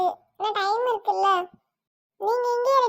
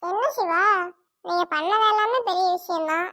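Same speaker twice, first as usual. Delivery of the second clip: usual speaking voice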